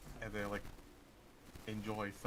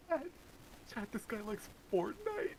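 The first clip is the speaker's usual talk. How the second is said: mocking voice. Mocking a Fortnite fan.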